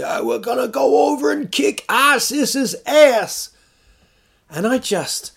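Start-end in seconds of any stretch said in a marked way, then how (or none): 0.0-3.5 high, loud hollering impression; Sarah Palin. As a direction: holler it like a rousing rally cry. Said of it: "she says".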